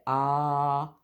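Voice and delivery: open-mouthed "Ah" like at the dentist; doctor's ah. Shown as a demonstration. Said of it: "open wide and say".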